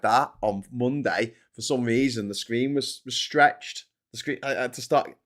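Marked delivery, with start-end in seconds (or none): none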